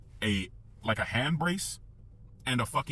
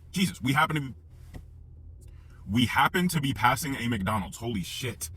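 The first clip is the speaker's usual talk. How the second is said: plain reading voice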